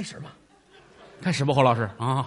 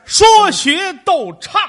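louder, higher, slowed, each word stretched; a crosstalk straight man announcing the four arts; drawn-out loud announcement; 13 semitones higher, 10 dB louder, slower than usual. Acted. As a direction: proclaim it like a stage title.

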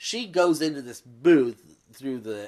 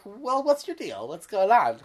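mock nosy housemates; 8 semitones higher, 4 dB louder than usual. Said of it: "And they're all like".

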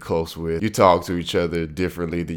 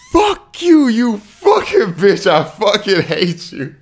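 mock furious yell, very high and loud; livid; 10 dB louder, slower than usual. Friend cursing out the prankster over the phone, livid. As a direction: imitate a livid friend screaming curses, drawn out. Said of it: "he was livid".

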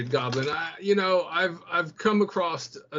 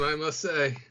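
ordinary talk

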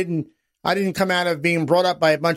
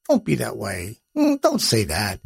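mother's gentle scolding; 8 semitones lower than usual. Retold or imitated. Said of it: "as if to say".

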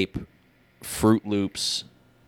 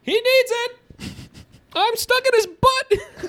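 high falsetto wife voice; squeaky trapped rich wife; eccentric millionaire. Pretend. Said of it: "They say".

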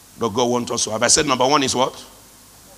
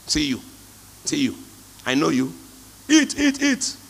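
mocking taunt. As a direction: taunt them, then squeal eat eat. Mocking someone changing.

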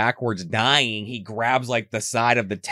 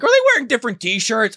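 much higher and louder, bewildered question; half-mocking his own confusion watching the show; puzzled self-quote; 13 semitones higher, 6 dB louder than usual. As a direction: blurt it out, confused and lifted. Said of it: "but I was like".

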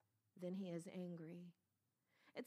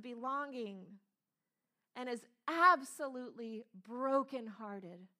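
fervent preaching; 5 semitones higher, 8 dB louder than usual. Acted. Preaching at full force.

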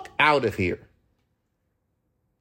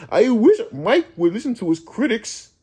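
mocking critic; 5 semitones higher than usual. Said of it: "it's like the last album".

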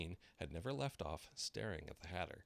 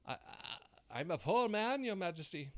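high, loud trembling plea; 12 semitones higher, 6 dB louder than usual. A narrator's reading. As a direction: stammer it out in fear. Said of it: "in a trembling voice".

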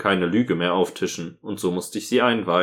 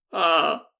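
mock ecstatic; 10 semitones higher than usual. Real, not acted. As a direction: overact the swooning highlight. Mocks the cheesy scene. (gespielt verzückt)

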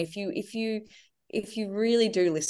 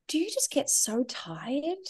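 put-on higher, softer, slow little-boy voice; soft child's question; 10 semitones higher, 7 dB quieter, slower than usual. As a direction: ask gently like a sleepy child in bed. Oscar asking in bed at night.

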